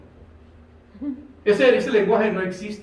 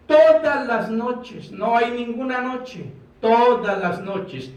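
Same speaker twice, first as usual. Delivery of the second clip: drawn out; 5 semitones higher, slower than usual. Acted. Preacher rereads psalm with emphasis.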